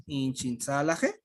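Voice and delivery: "Inchintzalaje" said slowly, each syllable clear; teacher spells it out by ear; careful model word. Shown as a demonstration. Teacher models the new word for students, slow and careful. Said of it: "Por ejemplo".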